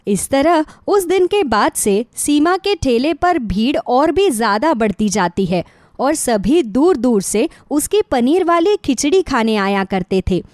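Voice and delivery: ordinary delivery, nothing marked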